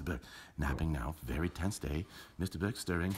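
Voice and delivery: hushed commentary. Pretend. Commentator confiding about the car keys in hushed tones. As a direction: murmur like a golf announcer.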